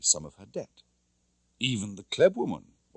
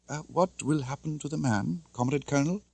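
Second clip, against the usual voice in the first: put-on timid young woman; 6 semitones higher than usual. A narrator's reading. Tatiana timidly asks the colonel about the man, hesitant. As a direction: ask nervously, a little hushed.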